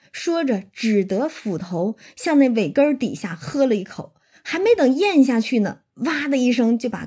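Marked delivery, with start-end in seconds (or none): none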